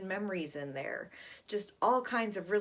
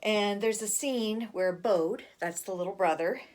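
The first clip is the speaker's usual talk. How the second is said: normal talking voice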